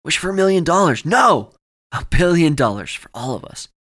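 high, loud, excited blurting; urgent shouting. Acted. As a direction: cut in loudly with a frantic demand. Friend urgently blurts a bigger wish.

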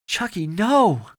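high, loud, drawn-out plea; whimper. A narrator's reading. Owen pleads beside his stricken friend, whimpering. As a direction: wail it in dismay. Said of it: "Owen whimpered".

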